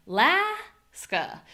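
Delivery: Alaska slow with reduced a's and strong las; a-LAS-ka, outer vowels reduced; stress on LAS. Shown as a demonstration. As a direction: stretch Alaska, leaning on las. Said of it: "We reduce".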